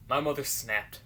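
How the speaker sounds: ordinary talk, nothing marked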